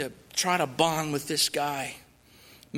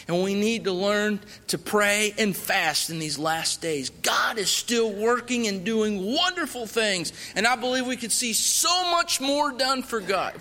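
preaching appeal; 4 semitones higher than usual. Acted. Missionary urging the church to pray, fervent preaching. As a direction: urge the church to pray and fast.

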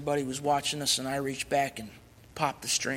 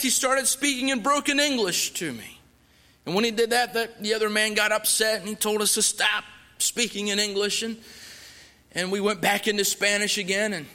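ordinary talk

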